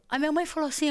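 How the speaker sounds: usual speaking voice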